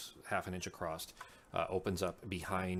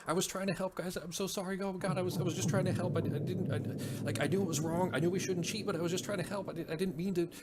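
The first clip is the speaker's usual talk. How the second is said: much higher, tearful acted panic; high-pitched, freaking-out guilty wail; panicking in tears; 12 semitones higher, 4 dB louder than usual. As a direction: blurt a frantic, stammering apology as if sobbing. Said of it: "panicking and freaking out in tears".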